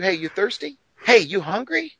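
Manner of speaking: vendor pitch. Retold or imitated. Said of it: "this guy says to you".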